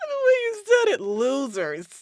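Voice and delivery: words squeezed out through giggles; helpless laughter. Real, not acted. To the friend who called them losers, cracking up.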